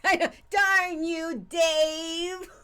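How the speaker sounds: loud, high cry of defiance; outraged hero shout. Acted. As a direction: shout the villain's name.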